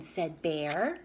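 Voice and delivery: plain reading voice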